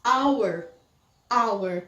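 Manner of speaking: "hour" said as "our", no H; careful model of "hour" with the H dropped; silent H. Shown as a demonstration. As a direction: start "hour" straight on the vowel. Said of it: "aqui a gente não pronuncia".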